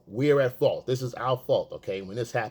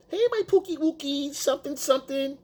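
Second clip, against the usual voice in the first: mock baby talk in a high voice; up-high frequency; 11 semitones higher than usual. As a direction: parody a boyfriend gushing pet names, pitched way up. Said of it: "up here with your frequency is way up here".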